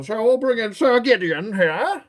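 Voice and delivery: high, loud hammed-up presentation; put-on knight voice. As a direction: grandly announce the knight's entrance, pitched high. Knight voice returns to present figure.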